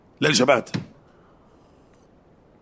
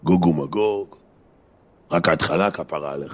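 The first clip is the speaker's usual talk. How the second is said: lower, measured voice of doom, lightly put on; mock scaremonger; 4 semitones lower, slower than usual. Scaremongers warning a friend of Gog and Magog, mock ominous. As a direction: imitate someone ominously warning a pal. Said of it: "שיש כאלה שמפחידים".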